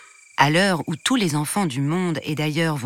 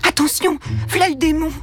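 high-pitched, louder warning cry; alarmed servant; 10 semitones higher, 3 dB louder than usual. Acted. Servant warns the others the little devil is coming, alarmed. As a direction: sound the alarm as he comes.

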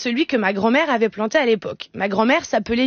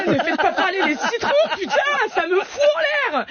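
laughing; 8 semitones higher than usual. Retold or imitated. Laughing outburst at lemons.

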